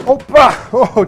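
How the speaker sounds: sudden yelp as they lose footing; jolted loud cry of surprise; startled exclamation. Real, not acted. Slips going backwards down steps.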